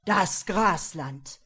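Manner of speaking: slow, loud, furious demand; snapping impatiently. A narrator's reading. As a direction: bark at the servant. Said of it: "herrschte er nun ungestüm los".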